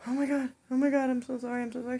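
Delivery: fast, high, loud retold panic; mortified rush of sorrys; frantic apology. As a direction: blurt apologies in a mock fluster.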